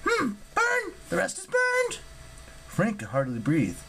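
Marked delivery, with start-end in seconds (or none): 0.0-2.0 very high, squeaky creature voice; excitable Ella. Acted. Ella breaks off her recital.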